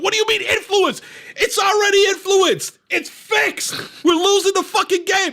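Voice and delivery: high shouted imitation of a father; hammed-up fury over a rigged game; outraged retort. Father rages at guard's rule.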